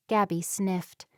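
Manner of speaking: plain reading voice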